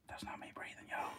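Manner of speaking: hushed whisper so the huffing stays audible. Real, not acted. Keeper whispering to viewers over the snake's huffing, a real whisper. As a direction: breathe it out without voice.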